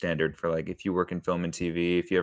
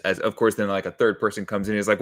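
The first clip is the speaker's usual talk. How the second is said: ordinary talk, nothing marked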